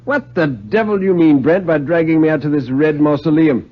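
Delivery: a mock-outraged writer bursting in; indignant Stone. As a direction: bark it at the host as a protest. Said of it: "he said".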